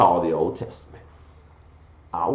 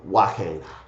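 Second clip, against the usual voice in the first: ordinary talk, nothing marked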